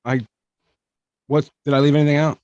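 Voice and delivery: ordinary talk, nothing marked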